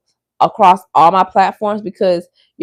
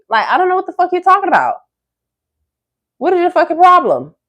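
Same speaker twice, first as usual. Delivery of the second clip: high, fast outraged yell; 10 semitones higher, 4 dB louder than usual. Real, not acted. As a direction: blow up at the troll. Peak of rant.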